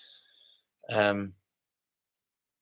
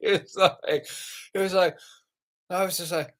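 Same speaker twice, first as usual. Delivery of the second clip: laughing; 8 semitones higher than usual. Real, not acted. Giggling while telling the story.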